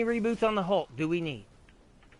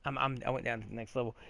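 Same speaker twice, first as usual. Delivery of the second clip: rushed aside; 6 semitones lower, 6 dB quieter, much faster than usual. Real, not acted. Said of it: "notice I, I emphasize the word super".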